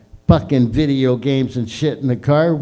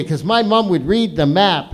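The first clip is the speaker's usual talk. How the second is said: ordinary talk, nothing marked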